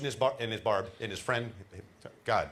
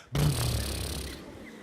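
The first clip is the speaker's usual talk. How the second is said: low gurgling mock laugh; deep bubbling laugh; 3 semitones lower than usual. Shown as a demonstration. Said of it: "everybody do that once".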